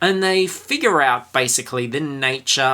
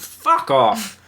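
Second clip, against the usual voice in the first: swearing tirade; 10 semitones higher than usual. Retold or imitated. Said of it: "they get told straight out".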